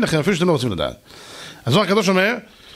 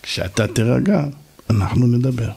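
lower, maddeningly composed reply; gentle calm voice; 4 semitones lower than usual. Acted. Calm man to his shouting opponent, gently. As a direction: answer the yeller with unruffled calm. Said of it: "פתאום קול כזה עדין".

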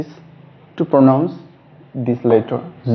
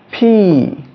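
P drawn out high with a puff of air; long, emphatic P; 8 semitones higher, 3 dB louder than usual. Shown as a demonstration. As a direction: pop and stretch the letter P. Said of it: "You need more duration and emphasis".

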